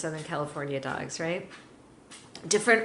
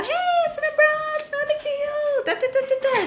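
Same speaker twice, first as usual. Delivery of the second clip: much higher, fast, sing-song cheerful; making light of it; 4 dB louder, faster than usual. Shown as a demonstration. Trainer showing owners how to present an umbrella, bright and high. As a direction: chirp it brightly so the dog relaxes. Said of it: "make light of it".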